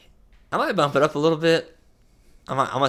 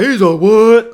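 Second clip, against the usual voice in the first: mock shock; 9 semitones higher, 12 dB louder, slower than usual. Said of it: "if people are like".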